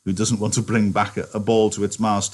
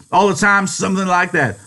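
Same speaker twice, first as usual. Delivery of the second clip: loud, high, bitter; angry shout; 12 semitones higher, 7 dB louder than usual. Acted. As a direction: hammer home the complaint. Repeated in the rant.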